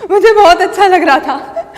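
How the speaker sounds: loud laugh-filled delight; gleeful gloating. Acted. Wife savouring husband's agony, gloating. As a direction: relish it, bursting with laughter.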